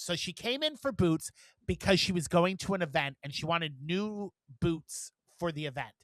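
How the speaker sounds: ordinary talk, nothing marked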